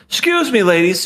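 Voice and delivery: high, put-on sing-out; mock suave interrupter. Imagined goofball cutting in on the ladies, high-pitched. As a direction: call it out in a goofy high voice. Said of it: "to the point where he said".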